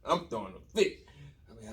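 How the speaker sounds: ordinary delivery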